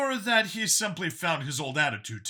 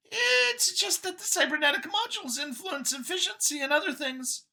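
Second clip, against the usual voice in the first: much higher voice, calm product explainer; Xeno scientist, matter-of-fact. A narrator's reading. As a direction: explain it like a brochure, pitched high.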